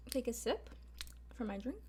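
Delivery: ordinary delivery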